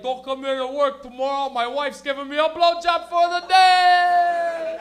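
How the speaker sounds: very loud, high celebratory bellow, last word held long; ecstatic boss shouting. Acted. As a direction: announce it like a wildly celebrating boss. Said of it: "Your boss would be like".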